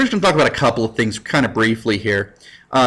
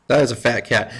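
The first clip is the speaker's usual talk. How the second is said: ordinary talk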